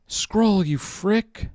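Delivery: frustrated outburst at the software; annoyed yell at the screen. Real, not acted. Streamer yelling at his stuck scroll wheel, annoyed.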